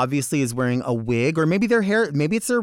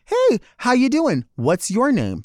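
friendly greeting; 7 semitones higher than usual. Shown as a demonstration. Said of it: "okay for me to say".

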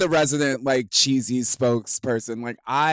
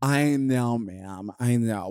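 ordinary talk

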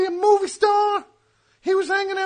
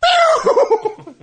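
high, loud mouth noise for a fast exit; whoosh sound effect; 8 semitones higher, 6 dB louder than usual. Acted. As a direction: zip off with a pew.

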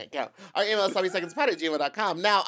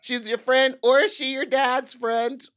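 announcer introduction; 7 semitones higher, 4 dB louder than usual. Pretend. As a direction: build the guest up line by line. Announcing the guest.

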